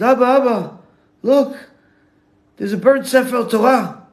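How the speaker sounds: high, loud distraught pleading, no real sobs; imitated hysterical crying. Crying boy shows father the burnt scroll, imitated hysteria. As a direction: act a panicked child urgently calling for father. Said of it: "he's crying hysterical, can't stop crying".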